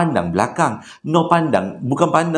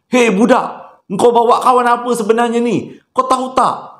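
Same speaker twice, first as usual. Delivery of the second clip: higher, louder angry telling-off; angry scolding; 9 semitones higher, 3 dB louder than usual. Retold or imitated. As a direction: scold the kid hard. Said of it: "pakcik tu marah Nur".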